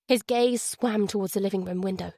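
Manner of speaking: ordinary delivery, nothing marked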